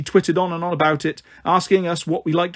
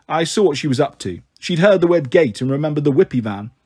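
plain reading voice

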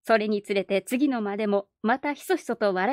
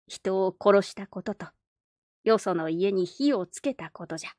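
put-on creaky wise old man; 2 semitones lower than usual. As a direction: speak like a wise old grandfather. Old man explains gravely.

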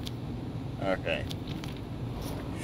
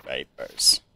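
much lower, put-on deep voice for papers; 9 semitones lower, 3 dB quieter than usual. Gamer playing the inspector, deep mock voice. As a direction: sink the voice low and heavy. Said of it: "I'll just do a deep voice".

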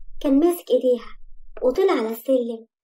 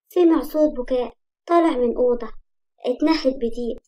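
usual speaking voice

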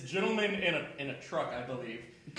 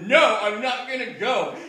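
raised-voice, higher-pitched protest; acted-out indignant no; retold insistent refusal; 4 semitones higher, 7 dB louder than usual. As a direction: refuse loudly, re-enacting the argument. Retold refusal, repeated to friend.

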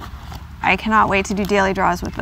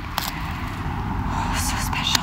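awed whisper; 9 dB quieter, much slower than usual. Real, not acted. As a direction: whisper it in hushed awe. Hushed over the cards.

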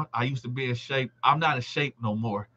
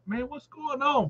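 higher, startled wondering; surprised onlooker; 10 semitones higher, 5 dB quieter than usual. Retold or imitated. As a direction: play a shocked bystander asking. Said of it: "everybody over there looking like".

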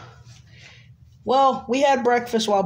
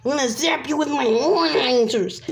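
a higher mock menacing threat, a bit more effort; more convincing threat; 6 semitones higher than usual. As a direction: push the threat up to sound convincing. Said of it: "Let's make this seem more convincing".

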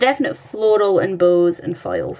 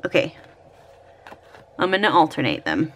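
usual speaking voice

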